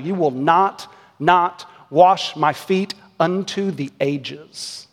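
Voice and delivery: each "not" set apart and stressed; slow, weighty refusal, stretching out "unto the ages"; emphatic double negative. Retold or imitated. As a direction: hammer "not, not", then draw out every word. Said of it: "as much negative emphasis as possible".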